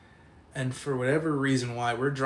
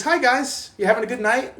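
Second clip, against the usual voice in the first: high, loud, carefree greeting; cheery Jimmy; 11 semitones higher, 7 dB louder than usual. Retold or imitated. Mocking Jimmy's breezy greeting to his mud-soaked friends. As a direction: play the drunk friend who's oblivious. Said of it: "He goes".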